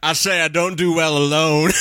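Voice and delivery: lightly voiced cartoon rooster; a touch of ailing cartoon rooster; mock deathbed rooster voice; Foghorn Leghorn. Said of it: "Foghorn Leghorn died by his family".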